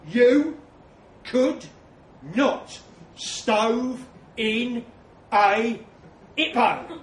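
YOU, COULD, NOT punched loud, one at a time; loud, slow, separate stress on each word to HIPPO; every word emphasised. A narrator's reading. Narrator squaring up to Trev, stressing every word from YOU to HIPPO. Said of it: "emphasise every word for effect".

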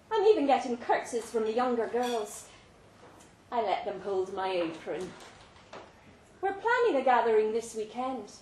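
plain reading voice

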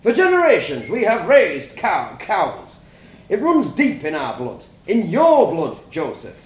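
a father preaching tradition to his family; stern farmer father lecturing. A narrator's reading.

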